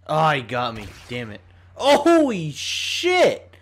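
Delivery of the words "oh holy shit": loud, high, drawn-out swearing; startled shout. Real, not acted. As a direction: yell it out in shock, slowly. Startled yell during game.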